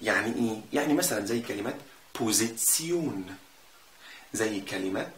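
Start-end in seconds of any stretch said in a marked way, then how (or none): 2.2-3.4 slow بوزيتسيون with TS before the long last syllable; TSION ending. Shown as a demonstration. Teacher giving an example word, slow TS in بوزيتسيون. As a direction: stretch بوزيتسيون, tion said as tsion. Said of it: "بنطقهم تسيون".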